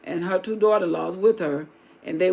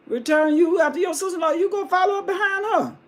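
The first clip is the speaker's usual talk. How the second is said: higher pitched urging voice; urging Naomi; 7 semitones higher than usual. Acted. Naomi urging Ruth to follow her sister-in-law, high-pitched. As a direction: push her away, rising higher.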